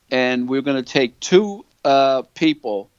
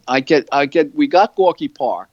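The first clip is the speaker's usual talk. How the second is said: ordinary talk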